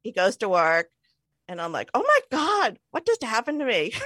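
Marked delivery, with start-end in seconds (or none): 1.9-3.9 high-pitched mock astonishment; retold dismay. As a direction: replay a sudden realization, pitched way up. Retold realization after husband leaves.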